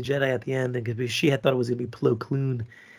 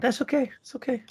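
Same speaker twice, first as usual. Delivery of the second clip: mock soothing, high; 10 semitones higher, 3 dB quieter than usual. Mock consoling the host.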